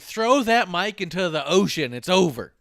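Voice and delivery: excited mock shout. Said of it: "I was like".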